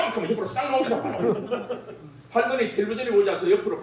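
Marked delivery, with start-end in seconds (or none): none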